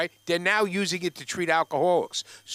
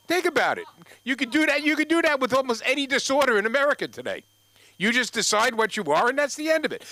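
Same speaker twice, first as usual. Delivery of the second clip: higher, louder rant; mock rant; 9 semitones higher, 4 dB louder than usual. Real, not acted. To the audience, ranting. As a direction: build it into a rant.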